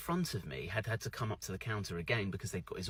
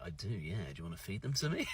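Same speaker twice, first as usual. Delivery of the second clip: mock flirty reply; 7 semitones lower, 7 dB quieter than usual. Brit teasing the cookie lady back, low, soft and flirty. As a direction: lower and softer, flirty, laughing through it. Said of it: "I was like".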